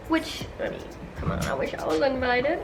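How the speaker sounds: soft, half-whispered envious aside; barely voiced jealous murmur to the viewers; hushed aside. Real, not acted. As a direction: drop your voice for a wishful little confession.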